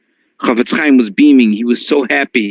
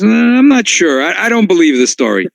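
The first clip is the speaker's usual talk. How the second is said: amused doubt; 6 semitones higher than usual. Retold or imitated. Judge answers with a smile.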